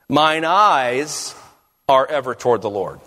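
"eyes" drawn out, then a pause; "eyes" held up as the key word; stress on EYES. Shown as a demonstration. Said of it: "place the emphasis on different".

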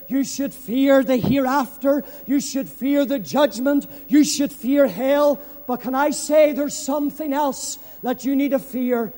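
ordinary talk, nothing marked

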